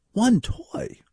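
high, pinched disbelief; high mock outrage; incredulous echo. Real, not acted. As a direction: echo it back, appalled.

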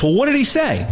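imitating an impatient detective, pitched high; pressing question. Retold detective pressing for the message.

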